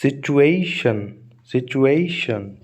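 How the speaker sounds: Situation very slowly, "ए" held long; stress before final syllable. Shown as a demonstration. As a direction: stretch the "ए" in situation, then let "शन" fall. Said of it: "शन से just पहले वाले". (Situation में "ए" पर खींचकर ज़ोर)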